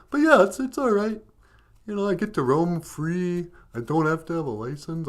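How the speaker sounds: lively goofy dog voice; Benjamin. Pretend. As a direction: chat away as a happy-go-lucky goofy dog. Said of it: "you know, goofy of the two".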